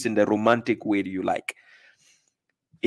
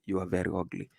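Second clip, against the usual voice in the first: much softer, lower gentle insult; said gently; 6 semitones lower, 12 dB quieter, faster than usual. Shown as a demonstration. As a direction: murmur it sweetly up close. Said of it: "say it gently".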